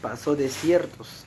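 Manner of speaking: loud, ringing sermon voice; fervent preaching. Acted. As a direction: preach it out to the congregation. Preaching rises to full force.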